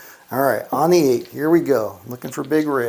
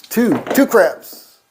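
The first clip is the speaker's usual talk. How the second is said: 주사위에 간절히 외치듯; pleading call; 6 semitones higher, 6 dB louder, slower than usual. Real, not acted. Begging the dice for craps.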